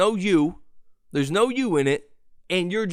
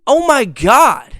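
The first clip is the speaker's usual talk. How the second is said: exasperated disbelief; 6 semitones higher, 10 dB louder, slower than usual. Real, not acted. Incredulous exclamation at mispronunciation.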